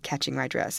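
ordinary delivery, nothing marked

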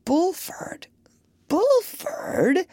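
puzzled whisper to himself; muttered. Acted. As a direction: whisper the name, baffled. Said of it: "muttered the doctor".

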